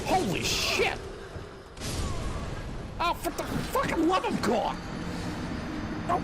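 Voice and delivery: high-pitched frustrated cry; exasperated outburst. Real, not acted. Streamer reacting to trouble in the game, exasperated outburst. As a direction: exclaim in real gaming exasperation.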